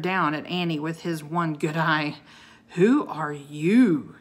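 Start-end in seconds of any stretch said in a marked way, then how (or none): 2.7-4.2 slow, loud, imposing challenge; demanding. A narrator's reading. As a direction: demand it word by word. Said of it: "he demanded".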